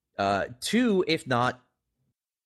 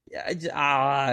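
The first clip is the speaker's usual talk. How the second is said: ordinary delivery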